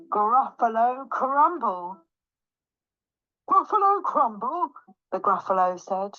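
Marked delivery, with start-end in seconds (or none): 0.1-2.0 slow, relished menace; measured, drawn-out dish name; deliberate threat. Acted. Mouse threatens with favourite food. 3.5-4.7 high, alarmed echo; aghast questioning cry; horrified Gruffalo. Acted.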